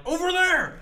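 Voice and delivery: high shouted guard alert; enemy guard shout. Retold or imitated. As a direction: yell it like a guard. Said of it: "they're like".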